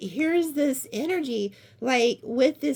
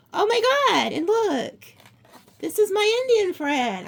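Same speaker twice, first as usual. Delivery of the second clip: gasping, much higher and louder; excited gasp; 8 semitones higher, 5 dB louder than usual. Real, not acted. Reader recognizing her friend in the cards, thrilled.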